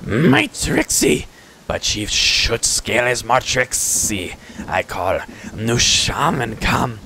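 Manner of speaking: slow, drawn-out creature voice; crafty kobold, words dragged out; kobold chief. Acted. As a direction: boast in halting, stretched pidgin. Kobold chief answers the player.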